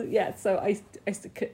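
ordinary delivery

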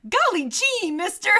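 put-on high, eager golly-gee boy; wide-eyed small-town kid; wholesome 1950s kid. Hitchhiker kid voiced in retelling.

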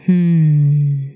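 low, loud, eerie hum; a deep spooky growling hum; scary voice hum. Acted. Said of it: "I wonder if I have that scary voice still".

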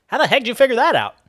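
high, loud, quick amazement; amazed rapid-fire question; astonished question. Acted. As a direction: blurt it out in disbelief. Hazel astonished at the homemade stuff.

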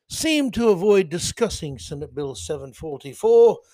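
put-on British newsreader accent. Host reads a news story to entertain listeners, put-on British accent. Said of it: "I've got my British accent out of the way".

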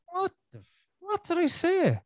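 high, pinched mock of his wife; imitating the wife. Husband mimics his wife's baffled retort, high. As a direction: squeak out her exasperated reply. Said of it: "my wife would be like".

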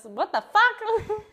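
slow, high-pitched mock outrage; retold outraged call. Host retelling friend's phone call, mock outrage. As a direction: imitate an indignant friend on the phone. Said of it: "She called us".

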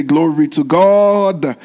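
slow drawn-out Glory to God; call and response. Shown as a demonstration. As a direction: stretch "Glory to God" out slowly for the crowd. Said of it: "Somebody say".

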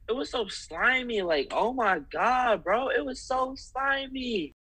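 high, drawn-out disgusted wail; grossed out. Real, not acted. Telling a friend about Frank's rebirth scene, grossed out. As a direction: squeal it out, slowly, in real revulsion.

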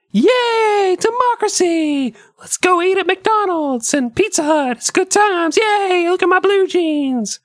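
very high, gushing excitement; giddy high voice. Retold or imitated. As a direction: squeal it like a thrilled kid. Giddy Russian citizens quoted.

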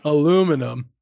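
"Aluminum" as a-LOO-mi-num; American "Aluminum". Shown as a demonstration. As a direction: stress the LOO in "Aluminum", no -ium. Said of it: "Say aluminium".

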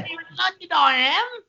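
hammed-up London greeting; a cheeky fake Cockney hello; mock English accent.

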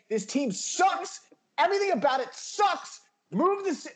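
worked-up tirade, key words hit hard; passionate rant. Real, not acted. Fan railing at the team's owner, passionate rant. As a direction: let loose on a team you're fed up with. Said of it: "Just passion".

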